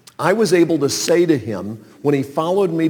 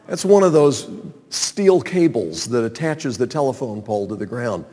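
normal talking voice

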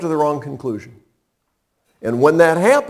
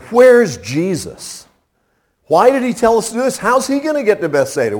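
frustrated disciples; 6 semitones higher than usual. Acted. Disciples' frustrated questions voiced.

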